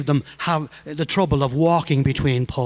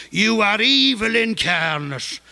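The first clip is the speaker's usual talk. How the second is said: put-on higher, slow Monaghan accent, falling on evil; 6 semitones higher, slower than usual. Kavanagh insulting Behan across the street, Monaghan accent. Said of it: "in that Monaghan accent".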